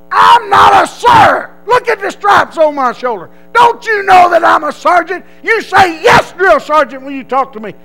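high, full-force scolding yell; irate sergeant bellow. Retold or imitated. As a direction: chew out the recruit at full volume. Sergeant berates recruit for saying sir.

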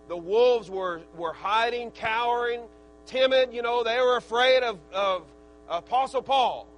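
preacher building up over the cowering wolves; full-force preaching. Acted. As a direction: preach it hard to the congregation.

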